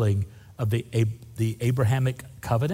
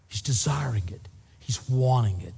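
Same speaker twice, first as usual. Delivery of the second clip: whisper. Real, not acted. Intimate emphasis on God's longing.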